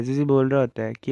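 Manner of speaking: ordinary delivery, nothing marked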